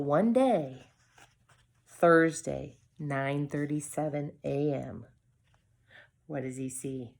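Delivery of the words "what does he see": ordinary delivery, nothing marked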